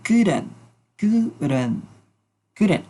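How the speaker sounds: "couldn't" repeated clearly; model pronunciation. Shown as a demonstration. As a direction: drill "couldn't" for the class. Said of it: "su pronunciación es la siguiente".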